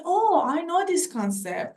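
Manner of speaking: ordinary talk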